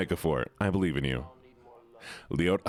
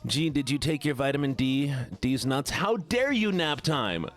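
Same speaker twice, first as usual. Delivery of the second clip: whiny voice; 2 semitones higher than usual. Pretend. Streamer doing a redeemed voice for chat, whiny. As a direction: whine it out in a silly pitched-up voice. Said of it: "we have the stupid whiny voice".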